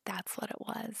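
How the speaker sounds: soft breathy whisper to herself; whispered realization. Real, not acted. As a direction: breathe the words out, barely voiced. Quiet closing realization.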